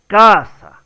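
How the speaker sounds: "casa" loud with CA stressed, then falling; emphatic, confirming "CÁsa"; correct stress on CA. Shown as a demonstration. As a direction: hit the first syllable of "casa" hard. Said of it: "la mayor fuerza de".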